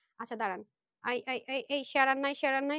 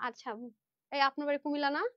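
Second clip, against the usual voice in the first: ordinary delivery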